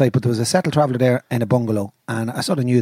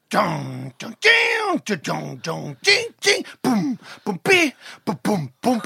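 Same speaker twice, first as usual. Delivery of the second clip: mouthed guitar and drum riff; imitating the band; 6 semitones higher, slower than usual. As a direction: vocalise the band's opening riff. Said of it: "they started going".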